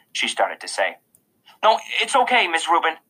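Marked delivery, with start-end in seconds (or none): 1.6-3.0 higher, hurried reassurance; quick reply. A narrator's reading. Boy to Miss Rubin on his way out, quickly. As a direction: brush it off quickly. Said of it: "I said quickly".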